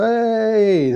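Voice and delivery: high loud surprise yell; jump-out shout. Retold or imitated. Assistant manager leaping out of the box at Jeff, loud high shout. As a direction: burst out to scare.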